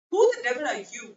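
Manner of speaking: a touch of affronted anger; angry challenge. A narrator's reading. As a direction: punch out the quoted question. Quoted angry challenge to narrator.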